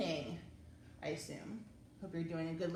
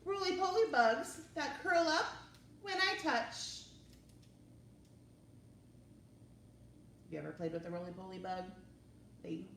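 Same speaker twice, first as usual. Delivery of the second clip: lively, expressive read-aloud; reading to children. Acted. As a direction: read it to little kids with wonder. Reading picture book aloud.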